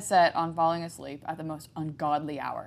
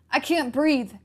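higher, louder choking plea; croaked; 8 semitones higher, 6 dB louder than usual. A narrator's reading. Boy being choked croaks in panic. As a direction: gasp it out while strangled. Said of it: "Jack croaked".

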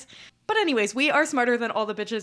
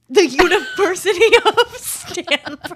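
slow, laughing, pitched up and loud; laughing mockery; 9 semitones higher, 9 dB louder, slower than usual. Real, not acted. Mocking her friend's mistake through laughter. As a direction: repeat the silly name through laughter.

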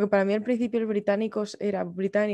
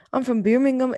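higher, louder imitated Brummie; Birmingham accent; 4 semitones higher, 5 dB louder than usual. Shown as a demonstration. Showing friend how Birmingham sounds, higher Brummie accent. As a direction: put on a strong Brummie accent. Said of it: "Era así".

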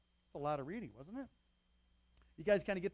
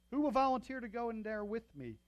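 raised, louder rallying question; asking for volunteers; 6 semitones higher, 6 dB louder than usual. A narrator's reading. David asking his men to join him, rallying call. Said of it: "David asked Amalek the Hittite".

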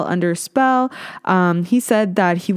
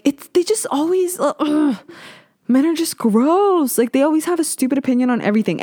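much higher, exasperated groan into a rant; heated rant; 8 semitones higher than usual. Real, not acted. Complaining to her viewers about men, heated and exasperated. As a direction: vent your disgust at men. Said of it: "I'm getting heated".

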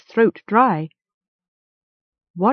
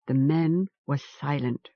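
ordinary delivery

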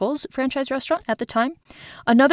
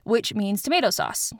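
ordinary talk, nothing marked

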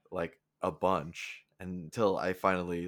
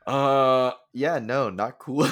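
ordinary talk

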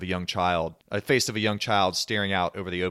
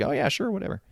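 higher-pitched, dismissive agreement; offhand brush-off; 6 semitones higher, faster than usual. Acted. Steve half-listens to Lucas's pitch, breezily dismissive. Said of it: "And Steve's like".

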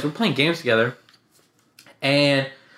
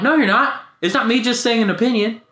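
high, emphatic contradiction; retold rebuttal; 9 semitones higher, 4 dB louder than usual. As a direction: push back in a raised pitch, re-enacting the quarrel.

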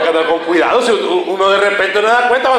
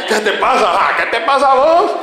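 mock jealous wife; 4 semitones higher than usual. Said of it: "Y le".

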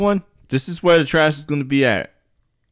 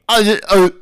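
put-on higher, garbled kid stammer; tongue-tied kid; 6 semitones higher, 4 dB louder than usual. Boss asks the kid's name, tongue-tied. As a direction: play a kid struggling to get words out. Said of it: "stop talking like that".

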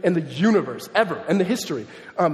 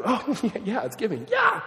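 hammed-up cheering, leaping high on the last yeah; mock excitement; 5 semitones higher than usual. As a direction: act out over-eager delight at giving. Mimics cheering when it is giving time.

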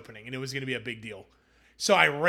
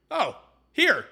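sudden lift, higher and louder, as the idea lands; excited realization; 9 semitones higher, 7 dB louder than usual. Retold or imitated. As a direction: blurt it out like a lightbulb moment. Sudden idea hitting like a brick.

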